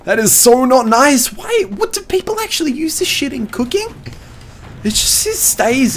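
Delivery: high, disgusted whine. Real, not acted. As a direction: recoil from the taste. Disgusted by vanilla extract taste.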